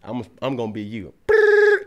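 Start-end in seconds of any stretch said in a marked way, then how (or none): 1.3-1.8 high buzzy ring; phone ring. Retold or imitated. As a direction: imitate a phone ringing. Imitating phone ringing.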